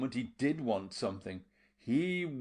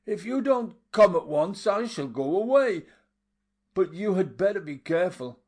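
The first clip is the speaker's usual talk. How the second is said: loud, high call through the door; calling out to a man outside; 8 semitones higher, 8 dB louder than usual. A narrator's reading. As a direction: call to him from inside the hut. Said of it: "called to him".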